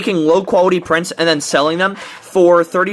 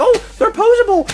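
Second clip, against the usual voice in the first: high mocking fanboy voice; mock the defenders in a silly high pitch; mocking fans. Reviewer mimicking brand fans, mocking. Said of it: "anyone who glazes it like".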